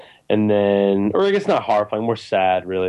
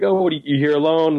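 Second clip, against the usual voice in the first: higher, quick, chirpy stranger greeting; mock friendly ranger; 6 semitones higher, faster than usual. Imitating the creepy park ranger greeting her, higher and fast. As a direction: lightly play the too-friendly man in the woods. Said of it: "he's like".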